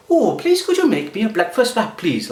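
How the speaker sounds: high, lilting put-on Welsh accent; mock Welsh accent asking sweetly; bad Welsh impression. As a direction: ham up a sing-song Welsh voice. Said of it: "Quite bad impression?".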